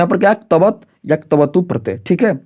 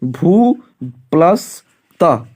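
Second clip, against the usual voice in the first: slow dictation; much slower than usual. Shown as a demonstration. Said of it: "उदाहरण है आपके सामने".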